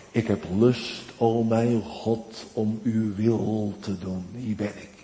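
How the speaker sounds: soft, low, slow reverent voice; quiet, intimate preaching; hushed devotion. Acted. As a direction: draw them in close with a hushed tone. Tender hushed preaching on Christ.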